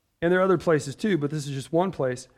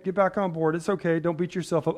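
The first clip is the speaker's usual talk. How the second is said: ordinary talk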